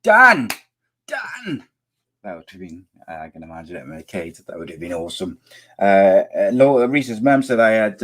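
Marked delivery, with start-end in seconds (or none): 0.0-1.6 high yelling "Dan!"; Alan Partridge shouting. Retold or imitated. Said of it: "Alan Partridge".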